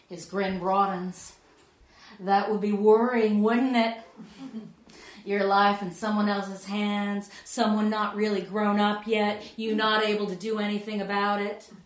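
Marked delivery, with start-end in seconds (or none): 2.2-11.7 louder, teasing put-on American accent; the young pilot needling a passenger in a hokey American accent. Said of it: "so I can do a hokey American accent".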